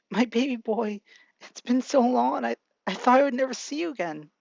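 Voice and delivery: emotional mother. A narrator's reading. Mother hugging her long-absent son, high emotional voice. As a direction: lightly act a mother overcome, pitched well up. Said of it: "Inko hugs him saying".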